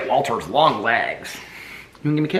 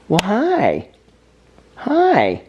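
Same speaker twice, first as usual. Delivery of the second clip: high bright hello to the puppies; high, louder puppy greeting; excited greeting; 8 semitones higher, 4 dB louder, much slower than usual. Real, not acted. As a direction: squeal hi at the pups.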